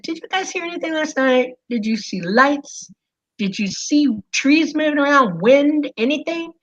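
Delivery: high, eager rush of questions to mom. Retold or imitated. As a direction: pitch it up, desperate to know. Asking mom about the lights.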